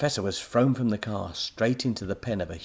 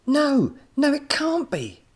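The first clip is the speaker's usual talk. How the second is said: distraught cry; 8 dB louder, slower than usual. A narrator's reading. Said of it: "Tommy cried".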